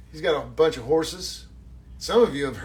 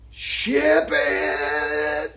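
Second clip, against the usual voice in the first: shout; 4 dB louder than usual. Real, not acted. Shouting as he ships the autograph.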